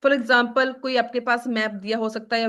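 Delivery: ordinary talk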